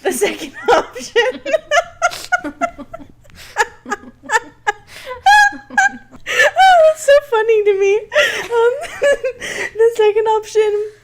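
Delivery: high, loud, laughing through the words; can barely get it out for laughing; helpless laughter. Real, not acted. Host reading fake fanfic titles, cracking up loudly.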